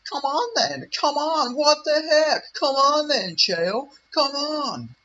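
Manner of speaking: high, loud mock-pleading squeal; mocking impression. Vlogger mimics the arguing host to mock him, high loud mock voice. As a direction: taunt in a put-on high-pitched voice.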